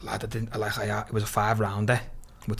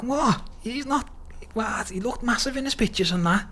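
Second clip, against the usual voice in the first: 2 high, incredulous what; 3 pitch jumps way up in stunned disbelief; 4 retelling his shock at the skinny opponent; 10 semitones higher, 4 dB quieter than usual. Retold or imitated.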